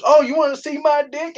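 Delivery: high, rising mock challenge; imitated retort. The guy answering the cursing, high mock retort. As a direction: throw it back in a put-on high voice. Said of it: "And the guy goes".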